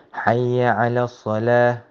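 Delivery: quick, chanted "حي على الصلاة"; recited adhan line. Shown as a demonstration. Teacher recites the adhan line for students. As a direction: call them to prayer.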